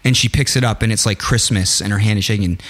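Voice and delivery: ordinary talk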